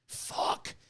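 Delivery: breathed out under the breath; whispered curse. Real, not acted. Ranting ex-cop to his viewers, a whispered curse. Exasperated aside at long careers.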